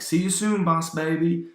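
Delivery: high, loud cartoon madman voice; unhinged villain. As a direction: proclaim the scheme in crazed high pitch. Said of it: "This guy's really unhinged".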